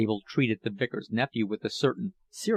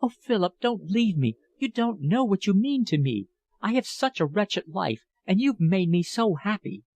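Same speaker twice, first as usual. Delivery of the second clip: higher, tearful pleading woman's voice; pleading older lover; 6 semitones higher than usual. A narrator's reading. Older woman begs departing young lover, higher and pleading. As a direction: beg him not to leave, voice lifted and aching. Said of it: "lover begs Philip to stay".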